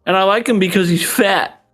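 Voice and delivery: high-pitched, innocent mock child voice; kindergartner. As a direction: blurt it out like a small kid. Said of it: "I'm just a kindergartner".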